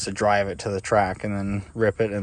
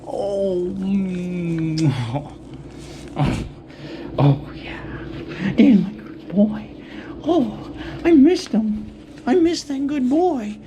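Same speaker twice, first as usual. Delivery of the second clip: squeaky praising a dog; 11 semitones higher, 5 dB louder, slower than usual. Real, not acted. Greeting the dog after a while.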